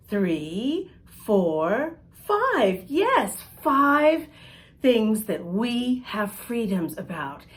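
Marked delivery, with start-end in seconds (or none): 2.3-4.3 cheery praise. Real, not acted. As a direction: cheer them on for getting it right. Teacher cheering correct count.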